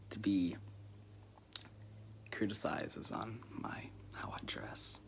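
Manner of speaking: hushed, half-joking admission; sheepish whisper. Retold or imitated. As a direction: drop to an embarrassed whisper. Retelling his own touchy reply.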